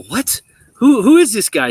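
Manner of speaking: rising incredulous what, then a loud high who is this guy; a stunned high schooler spotting his rival; shocked disbelief. Retold or imitated. Teen jumper sees the unknown rival arrive, loud shocked disbelief.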